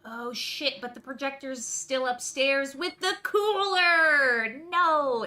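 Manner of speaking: loud, high "oh no" outburst; dismayed cry. Acted. Dismayed player realises cooler is upstairs.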